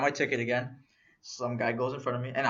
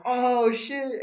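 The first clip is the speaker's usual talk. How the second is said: much higher, impressed exclamation; retold amazement; 9 semitones higher, 4 dB louder than usual. Friends reacting as the stranger brings the ticket, amazed. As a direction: let it leap up in delighted surprise.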